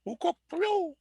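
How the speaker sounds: hooted in a piercing falsetto; a squeaky joke owl hoot; barred owl call. Retold or imitated. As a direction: shoot the hoot way up high. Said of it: "a little joke there".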